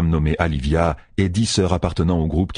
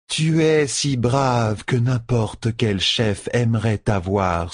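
slow, higher foreign woman teasing; teasing wife; 5 semitones higher, much slower than usual. Acted. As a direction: tease him slowly, dry. Leilwin teases her husband.

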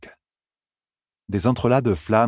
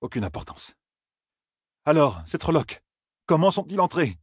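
a higher, insistent voice; pressing Rand; 10 semitones higher than usual. A narrator's reading. Rand insists on how the Trollocs got in, higher. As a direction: brush it aside and press on.

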